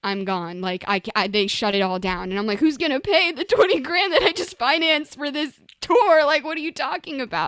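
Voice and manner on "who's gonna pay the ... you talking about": higher, louder exasperated rant; exasperated outburst. Retold or imitated. Musician ranting about tour money lost, higher and louder. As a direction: vent the disbelief.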